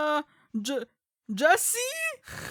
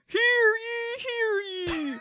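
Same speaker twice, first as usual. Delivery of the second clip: town crier; 6 semitones higher than usual. Acted. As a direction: call it out like a herald. Announcer calling out.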